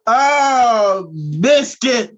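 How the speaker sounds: loud, high squeals of greeting; excited shouting. Acted. Host hailing a surprise guest, excited shouting. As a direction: shout it long and high, rising to a scream.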